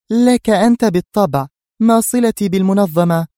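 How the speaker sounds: high, loud female voice; assured temptress. A narrator's reading. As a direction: answer briskly in a high feminine voice. Woman tempting boss with bribe.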